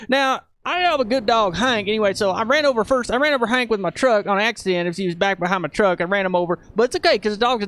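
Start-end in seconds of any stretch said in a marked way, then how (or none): 0.1-1.9 put-on rambling country cowboy with a Southern accent; Cowboy Craig. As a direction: spin a yarn like a good old country boy. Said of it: "Craig used to talk like this".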